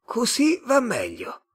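high, slow alien voice; slow, otherworldly Martian. A narrator's reading. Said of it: "disse il marziano in inglese".

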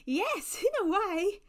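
high, loud, amused reply. A narrator's reading. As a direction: answer lightly, voice lifted high. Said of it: "The angel's laugh was like rippling water".

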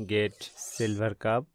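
normal talking voice

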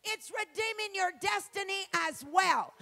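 loud, rising preacher's cry, shouting the last line; full-throated revival preaching; preaching at full force. Acted. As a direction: preach it out with everything. Preaching climax on redemption.

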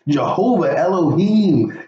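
ordinary talk

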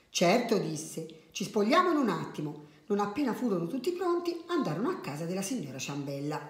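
soft storyteller with lively character lines; children's story narration. A narrator's reading. Adult reading a funny tale to children, animated storytelling. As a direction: read it gently, acting out each line.

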